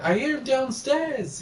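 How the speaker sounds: high, drawn-out mock yell; imitated yell. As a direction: call it out slowly, high-pitched, without full volume. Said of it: "yelling, like".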